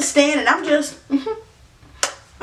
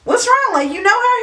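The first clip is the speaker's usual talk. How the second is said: suspicious surprise; 8 semitones higher, faster than usual. Retold or imitated. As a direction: catch him out mid-lie. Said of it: "I'm like".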